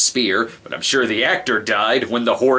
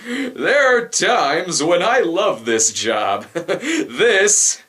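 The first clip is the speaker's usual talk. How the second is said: higher, laughing through the words; 8 semitones higher, much slower than usual. Real, not acted. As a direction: laugh while you say it. Laughing at "share booty" line.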